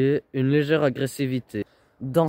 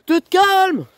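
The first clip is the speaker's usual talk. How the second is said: high, loud and sharp; shouted order; 5 dB louder than usual. Real, not acted. Boyfriend yells at raging girlfriend to calm down. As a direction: yell it as a command.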